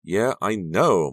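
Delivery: edgy, tired of hearing it. A narrator's reading. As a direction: snap back impatiently. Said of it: "Maya repeated edgily".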